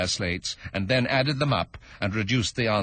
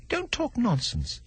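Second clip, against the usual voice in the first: high, slow bold retort; Alice talking back boldly to the Dormouse; slower than usual. Acted. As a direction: stand up to him. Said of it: "Alice said more boldly".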